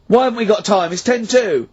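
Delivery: demanding it loudly; loud insisting. Real, not acted. Insisting the co-host play ritual.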